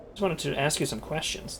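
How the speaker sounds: ordinary talk, nothing marked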